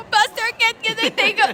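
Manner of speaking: high-pitched pretend tearful request; hammed-up weepy voice, way up high; mock crying. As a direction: imitate asking for a photo while crying. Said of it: "habang umiiyak".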